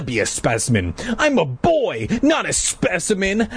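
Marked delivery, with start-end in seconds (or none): 1.2-3.5 angrily. Acted. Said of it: "I told him angrily".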